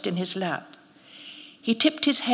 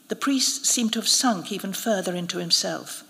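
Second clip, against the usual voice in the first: usual speaking voice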